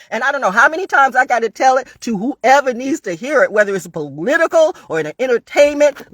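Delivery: loud, high shouting threat; furious warning. Real, not acted. Warning her critics, loud and furious. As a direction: let it rip at whoever comes for her.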